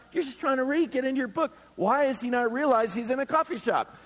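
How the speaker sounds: ordinary delivery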